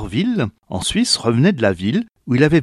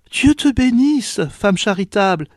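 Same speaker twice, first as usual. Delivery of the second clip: grateful mother; 8 semitones higher than usual. A narrator's reading. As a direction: bless the kind woman gently. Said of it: "dit la mère".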